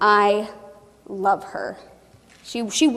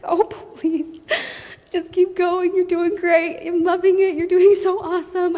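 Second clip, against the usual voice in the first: high, bright cheerleader encouragement; high-pitched encouraging coach; cheering on; 7 semitones higher than usual. Retold or imitated. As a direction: cheer them on from the sidelines. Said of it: "I'm sitting back and I'm like".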